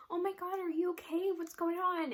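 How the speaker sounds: concerned nurse. Retold or imitated. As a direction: imitate a worried nurse checking in.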